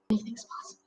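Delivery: soft unvoiced whisper. Storyteller whispers a playful reply to the puppet. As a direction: whisper it like a secret.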